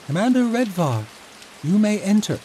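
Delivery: high, loud call through a closed door. Acted. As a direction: summon him in from across the room. Calling the commander in.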